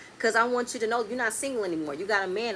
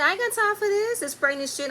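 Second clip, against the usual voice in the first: mocking the husbands; 11 semitones higher than usual.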